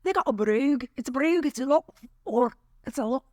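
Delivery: attempted Scottish brogue. Shown as a demonstration. Showing co-host other accents, attempted Scottish brogue. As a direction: try on a light Scottish burr. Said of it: "I can try to do other variants".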